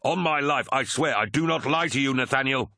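high, urgent, insistent appeal; earnest plea. A narrator's reading. Tarvitz begging his honor brother for trust, pleading. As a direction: plead with mounting pitch to be believed. Pleading to be believed under fire.

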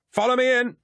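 high, full battle cry; rally the troops with a yelled order; commanding yell. A narrator's reading. Rescuer leading warriors into the fray, commanding yell. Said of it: "a voice was yelling, commanding".

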